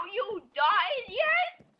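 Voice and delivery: slow, high, stretched-out question; silly slow high voice. Pretend. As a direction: drag it out in a goofy high voice. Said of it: "does that sound like me normally".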